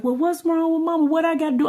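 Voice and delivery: put-on children's concern. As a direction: lightly voice the kids, pitched well up. Children voiced worrying about mother.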